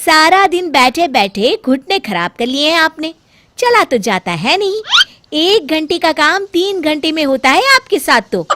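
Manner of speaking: high, loud, taunting daughter-in-law; mocking bahu. Bahu mocks her mother-in-law's slowness, high and loud. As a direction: needle the old woman about her knees.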